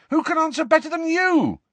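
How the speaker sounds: very high, loud outburst; exasperated. A narrator's reading. Harry outraged at Nick's refusal, exasperated. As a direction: demand it at the top of the voice.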